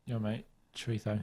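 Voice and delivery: quiet, blunt English tourist accent. Shown as a demonstration. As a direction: say it like a lazy Brit abroad. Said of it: "Just say".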